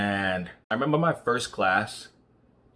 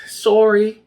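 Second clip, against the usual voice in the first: high, loud chirpy sorry; a Canadian apologising on reflex, sung out high and loud; mock Canadian sorry; 13 dB louder than usual. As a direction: imitate an overly polite Canadian apologising. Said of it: "everybody's saying sorry all the time".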